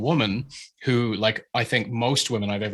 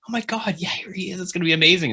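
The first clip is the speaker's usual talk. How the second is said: higher, gushing fan voice; gushing student; 8 semitones higher, faster than usual. Retold or imitated. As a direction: gush like an eager student seeing his hero. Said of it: "some people were like".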